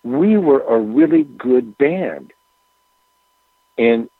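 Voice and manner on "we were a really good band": slow, each word weighed; slow, deliberate quote. Retold or imitated. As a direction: let every word land slowly. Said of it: "these are his exact words".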